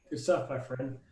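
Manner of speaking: usual speaking voice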